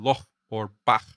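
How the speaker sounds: "loch" and "bach" ending in a back-of-mouth fricative, not k; velar fricative. Shown as a demonstration. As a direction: model the scraped ch in "loch" and "bach". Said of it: "is a voiceless velar fricative".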